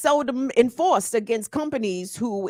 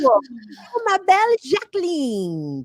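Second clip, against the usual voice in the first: put-on slow, higher, playful French-accented greeting to Jackie; a teasing West African French accent; mock French greeting; 5 semitones higher, 4 dB louder than usual. Host teasing Jackie with a playful French accent. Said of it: "like West African French".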